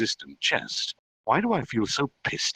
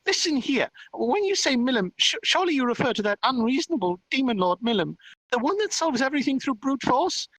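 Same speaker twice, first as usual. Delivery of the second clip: high, squeaky indignant voice; huffy little fairy, lecturing; 13 semitones higher than usual. Acted. Fairy demon lord objecting to being compared with Milim, huffy lecture. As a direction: scold haughtily as a tiny, self-important fairy.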